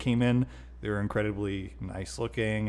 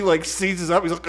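normal talking voice

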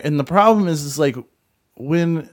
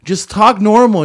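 retold angry demand; 6 semitones higher, 6 dB louder than usual. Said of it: "slam my hand on the table".

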